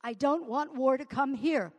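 impassioned outcry. Shown as a demonstration. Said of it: "repeated louder".

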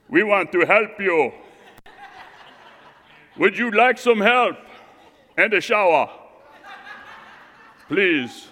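loud, high, chest-out offer of help with a laugh; puffed-up tough guy. Retold or imitated. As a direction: play the buff guy sizing him up, half laughing. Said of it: "I puffed up around him".

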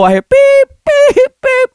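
mimicked cryopod alarm beeping. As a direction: chirp it like a machine alarm. Imitating alarm waking him.

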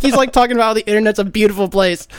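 normal talking voice

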